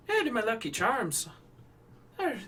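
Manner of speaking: high mock Irish lilt; hammed-up Irish charm; mock Irish accent. Shown as a demonstration. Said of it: "the Irish accent".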